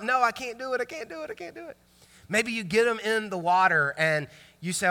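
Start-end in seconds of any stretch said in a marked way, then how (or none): 0.0-1.8 high, shrinking little protest; mock panicked child. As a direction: imitate a scared kid refusing, climbing in pitch and fading. Scared child refusing to jump.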